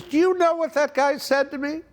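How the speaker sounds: very high, outraged mimicry; mock-outraged high-pitched question; indignant wife. Wife's indignant question, imitated.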